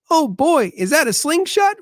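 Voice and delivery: put-on very high, loud child's voice; a dad mimicking his kid's excited question; imitating his son. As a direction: squeak it out like an excited little boy. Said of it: "that's my son".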